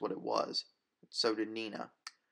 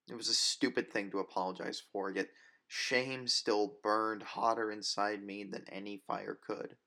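ordinary delivery

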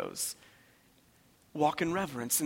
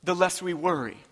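higher, driving preacher's refrain; full-force preaching; 6 semitones higher, 6 dB louder than usual. Real, not acted. Preacher urging the congregation to fear God not life, full-force preaching. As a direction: preach each repetition high and insistent.

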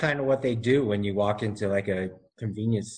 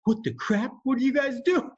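startled; 13 semitones higher than usual. Retold or imitated. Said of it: "was like".

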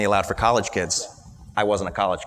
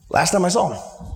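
ordinary talk, nothing marked

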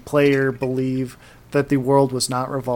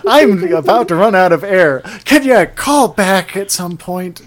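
louder, higher exasperated plea; harried pleading; 5 semitones higher, 6 dB louder than usual. Retold or imitated. Player nearly out of air to caller, harried. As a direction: fend off a badly timed caller.

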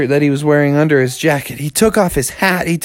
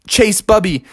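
ordinary delivery, nothing marked

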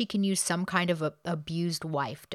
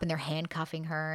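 ordinary talk, nothing marked

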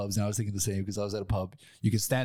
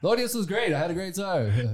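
bubbly; 7 semitones higher than usual. Real, not acted. Defends the audience, laughing.

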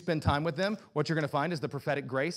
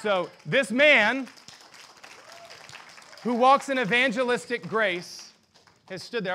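proclaiming; 7 semitones higher, 9 dB louder, slower than usual. Acted. Honouring Billy before the church.

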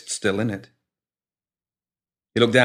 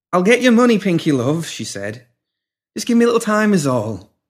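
placating; 10 semitones higher, 5 dB louder than usual. A narrator's reading. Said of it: "tried to make amends".

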